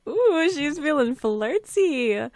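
high, drawn-out singsong tease; slow, high-pitched ooh of delight; excited teasing. Real, not acted. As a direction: stretch it out with teasing glee. Teasing reaction to flirting.

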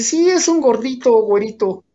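high and loud, eager to tell the news; excited tattling. Retold or imitated. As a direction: blurt it out like juicy gossip. Friend tells father about son.

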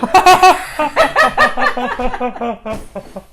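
squeaky high helium cackle; laughing on helium. Real, not acted.

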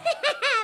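high fake laugh back at the kids; mock cackle. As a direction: cackle mockingly, pitched right up. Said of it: "I'm like".

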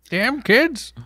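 high-pitched mock old-man grumble; put-on cranky old-timer; grumpy old man. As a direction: play the old man griping at kids. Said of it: "Old man yells at cars".

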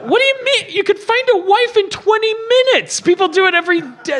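high-pitched, exasperated comeback; incredulous retort. Acted. Answering a man who must find a wife, incredulous. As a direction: argue back in disbelief.

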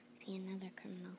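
hushed fast whisper. Acted. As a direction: whisper it to yourself quickly. Whispering thought aside.

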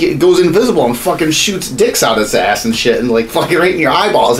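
usual speaking voice

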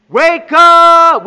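loud shouted wake up. Real, not acted. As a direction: yell it at the crowd. Shouting at the audience.

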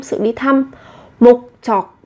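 normal talking voice

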